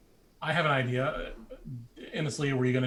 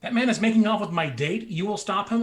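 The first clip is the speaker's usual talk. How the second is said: indignant demand; 6 semitones higher, 6 dB louder, faster than usual. Acted. Gnome complaining to security, demanding action.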